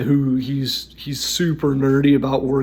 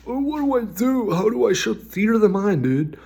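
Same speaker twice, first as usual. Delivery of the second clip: high, hammed-up whiny voice of a confused player; mock whiny question; 7 semitones higher than usual. Mocking a GM who asks how to run theater of the mind, high and whiny. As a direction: squeak out the question like a lost newbie.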